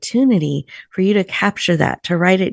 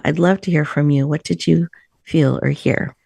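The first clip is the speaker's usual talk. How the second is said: ordinary talk, nothing marked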